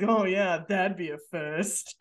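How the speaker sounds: vaguely British hairdresser, slowing at the end; Stewie. Retold or imitated. Hairdresser doubting Samantha, in a Stewie-like drawl. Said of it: "that's not a bad Stewie impression".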